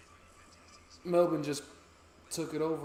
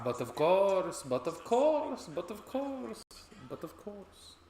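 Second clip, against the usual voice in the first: mock sing-song; 8 semitones higher, slower than usual. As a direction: stretch it out in a high teasing singsong. Drawn-out mocking repeat.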